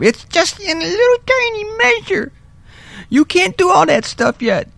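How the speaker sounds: tiny mocking high voice; mock squeaky voice.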